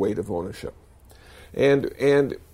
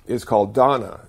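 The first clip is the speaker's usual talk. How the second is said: ordinary talk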